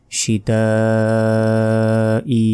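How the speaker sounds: শীতা with aa held very long, then short ই; slow drawn-out "shitaaaa-i" for শীতা ই; long elongation. Shown as a demonstration. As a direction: stretch the end of শীতা for several beats. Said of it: "চার second টেনে পড়তে হবে".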